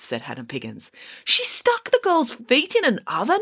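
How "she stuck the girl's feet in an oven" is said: high, incredulous question; shocked exclamation. A narrator's reading. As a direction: exclaim like a horrified boy listener. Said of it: "exclaimed Michael".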